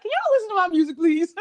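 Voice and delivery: high, sweet begging to followers; playful mock pleading; high pleading ask.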